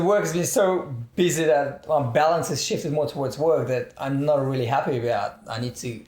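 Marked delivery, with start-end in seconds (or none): none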